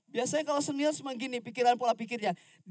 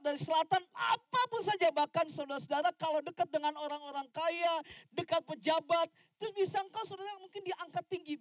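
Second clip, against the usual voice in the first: full force preaching; 6 semitones higher, 9 dB louder than usual. Acted.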